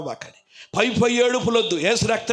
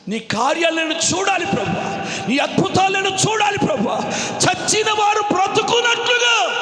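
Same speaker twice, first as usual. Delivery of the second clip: high, crying-out prayer delivery; full-force preaching; 13 semitones higher, 6 dB louder than usual. Real, not acted. Preacher modelling a plea to the Lord, impassioned. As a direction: build the plea to a shouted peak.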